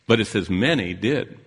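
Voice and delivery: ordinary delivery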